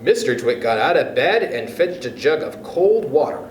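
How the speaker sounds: usual speaking voice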